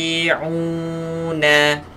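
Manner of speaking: Khashi'una with a clear ain, not a hamzah; teacher modelling ain. Shown as a demonstration. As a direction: stress the ain sound. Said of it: "baca dengan huruf ain".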